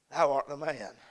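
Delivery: soft, hushed "Thou art the man"; quiet verdict. Retold or imitated. God quietly names the preacher's sin, hushed. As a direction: lay the verdict down gently, almost under breath. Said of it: "in the words of Nathan the prophet".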